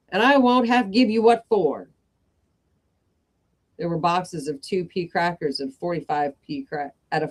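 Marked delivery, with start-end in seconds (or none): 0.1-1.9 loud, higher scolding shout; mum shouting. Acted. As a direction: yell at the kids. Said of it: "moms shouting".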